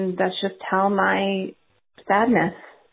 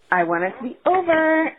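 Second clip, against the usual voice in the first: normal talking voice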